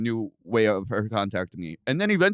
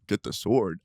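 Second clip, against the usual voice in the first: low, understated echo; unbothered puzzlement; 4 semitones lower than usual. Retold or imitated. Brother echoing an odd order on the phone, unbothered. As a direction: repeat it back lower, mildly puzzled.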